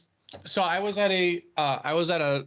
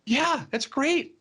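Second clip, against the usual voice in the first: amused defiance; 6 semitones higher than usual. Retold or imitated. Defending work to angry boss.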